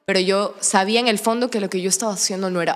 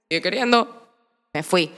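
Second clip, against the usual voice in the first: normal talking voice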